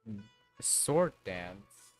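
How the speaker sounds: ordinary talk, nothing marked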